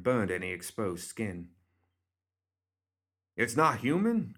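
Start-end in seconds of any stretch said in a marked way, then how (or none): 3.4-4.3 higher-pitched questioning call; mild yell. A narrator's reading. Cornelius pulling out his shotgun, mildly yelling. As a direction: call out in mild alarm, not full volume. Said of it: "Cornelius yelled".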